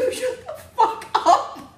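high, loud, drawn-out laughing cry; real laughing outburst, stretched out; cracking up. Real, not acted. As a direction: shriek it slowly through laughter. Laughing at clip's comeback.

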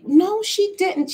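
high, slow indignant disbelief; mock outrage. Complaining about her daughter's new dog, high and indignant. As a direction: drag out the disbelief. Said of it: "I'm like".

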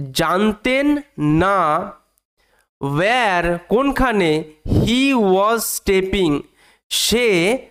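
very slow, with long gaps; word-by-word reading. Shown as a demonstration. Teacher translating the story for pupils, slowly, word by word. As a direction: leave space after each word and its translation. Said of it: "আমি একদম ভেঙে ভেঙে পড়ানোর চেষ্টা করবো".

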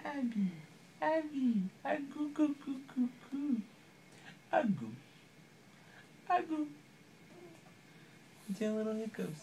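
high, soft cooing singsong. Real, not acted. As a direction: coo her name sweetly. Cooing at the newborn.

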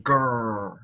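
playful "grrr" at a low pitch; mock Hulk growl. Streamer shows off muscles to viewers, mock Hulk growl. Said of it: "do you ever watch the Hulk?".

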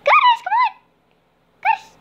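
high, loud coaxing call to the cat; sing-song call to bring the cat over; squeaky "come on" to a pet. Real, not acted. Calling her cat to fetch.

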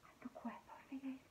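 hushed whisper of suspense; tense whisper. Acted. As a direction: whisper as danger nears. Tense moment, storyteller whispers.

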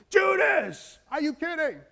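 high, loud disbelieving outburst; Jesus praying about Peter and Judas, exasperated; exasperated cry. Retold or imitated. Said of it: "This Peter".

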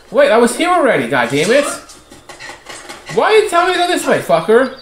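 high, loud frustrated complaint; real gamer frustration, voice shooting up; exasperated outburst. Real, not acted. Frustrated at game's directions.

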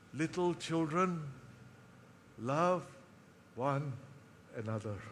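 lightly voiced old apostle, words dying away; frail old man. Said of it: "he would muscle his strength just enough".

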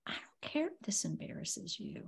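whispered, stubborn and soft; retold whisper. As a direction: breathe it out without voice. David retold, defiant quote.

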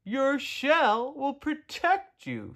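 high, drawn-out call. A narrator's reading. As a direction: call it up slowly, stretching the words. Turtles call up to Tudley.

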